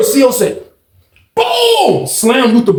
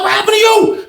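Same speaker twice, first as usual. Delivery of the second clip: alarmed question. Retold or imitated. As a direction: ask in shock. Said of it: "She's like".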